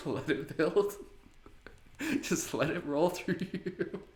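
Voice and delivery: high, giggly coaching through laughter; laughter yoga coach mid-laugh; laughing encouragement. Real, not acted. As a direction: cheer them on while still laughing.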